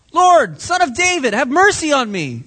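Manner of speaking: high, loud desperate cry for mercy; pleading shout. Acted. Blind man crying out to Jesus over the crowd, pleading shout. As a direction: call out to be heard over the crowd. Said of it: "he keeps shouting louder and louder".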